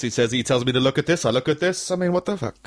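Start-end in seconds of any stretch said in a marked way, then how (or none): 1.3-2.6 put-on drifting Eastern European accent. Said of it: "It started going Eastern European".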